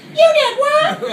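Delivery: very high, slow, drawn-out disbelief; shocked mom. Retold or imitated. Mom learning what her son did, very high slow shock. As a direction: play the horrified mother, stretch it out.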